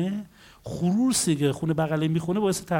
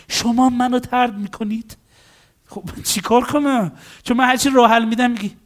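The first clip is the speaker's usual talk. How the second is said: higher, louder mock protest; acted exasperation; 5 semitones higher, 4 dB louder than usual. Counselor and rejecting client arguing, exasperated. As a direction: protest as if at your wits' end.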